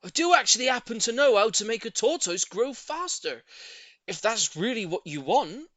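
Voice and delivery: high, loud offer; confident neighbour. A narrator's reading. Neighbour offering a secret to the lady, loud confident. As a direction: sound like you know a secret.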